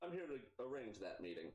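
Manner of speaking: usual speaking voice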